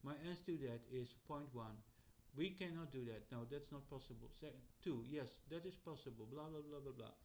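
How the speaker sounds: even, monotone assistant recital; robotic chatbot. Acted. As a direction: recite answers like an unfeeling machine. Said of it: "That's not how chat G P T talks".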